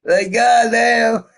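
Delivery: slow, high, loud goddamn; long loud drawn-out groan of disbelief; drawn-out exclamation. Real, not acted. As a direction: stretch it out in mock disbelief. Exaggerated exclamation.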